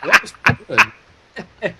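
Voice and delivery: laughing through the words. Real, not acted. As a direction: break up laughing. Laughing at the impression.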